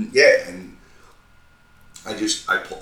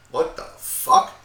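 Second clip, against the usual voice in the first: disbelief; 9 semitones higher, slower than usual. Retold or imitated. Disbelief at partner's news.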